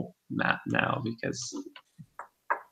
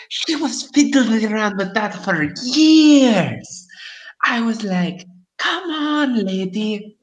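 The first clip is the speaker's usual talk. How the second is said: fortune teller; 7 semitones higher than usual. Acted. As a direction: boast like a gossipy hag. GM keeps crone voice.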